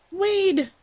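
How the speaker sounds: high, loud call. Acted. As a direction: shout his name across. Calling out to NPC.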